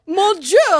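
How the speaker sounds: playful mock bonjour; imitating husband's French. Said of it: "And he was like".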